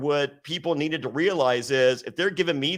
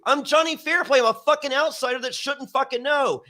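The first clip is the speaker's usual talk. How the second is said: much higher, loud, indignant outburst; heated retort; 10 semitones higher, 3 dB louder than usual. Retold or imitated. Snapping back at Don Callis in rehearsal, heated and high. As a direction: throw it back at him, fired up. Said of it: "I go".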